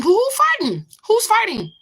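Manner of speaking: high indignant who; who, me, fighting; incredulous. Real, not acted. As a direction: pitch jumps up in disbelief. Denying accusation of fighting.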